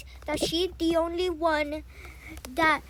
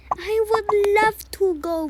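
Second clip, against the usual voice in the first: eager acceptance; 8 semitones higher, 7 dB louder than usual. Acted. Child accepts mother's offer.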